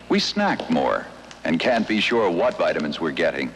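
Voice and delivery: normal talking voice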